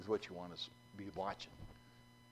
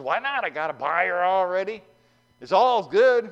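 loud, higher, carefree; dismissive farmer; 8 semitones higher, 14 dB louder than usual. Acted. John waving off the pastor's advice, loud and carefree. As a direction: wave off the advice happily.

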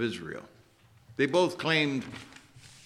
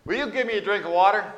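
higher, louder, curt demand; rude request; 8 semitones higher, 6 dB louder than usual. Retold or imitated. Preacher voicing Jesus asking the woman for water, mock rude. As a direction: bark the request without a please. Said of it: "kinda rudely says".

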